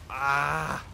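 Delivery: sudden loud yelp; startled yelp. Real, not acted. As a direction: yelp as you get hit. Hit in game.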